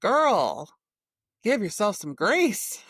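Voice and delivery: emphatic. Real, not acted. Urging her friend.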